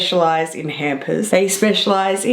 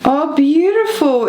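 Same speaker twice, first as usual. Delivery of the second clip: delighted; 7 semitones higher, 3 dB louder than usual. Real, not acted. Finding the lip balm.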